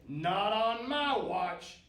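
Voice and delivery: cranky troll shouting him down; defiant troll. A narrator's reading. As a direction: stretch it out as a big troll's refusal. Troll refuses the goat passage.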